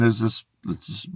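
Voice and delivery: ordinary talk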